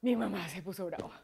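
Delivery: gasping mock-alarmed child reaction; gasp, then a hushed worried kid; mock shock. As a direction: imitate a child taken aback.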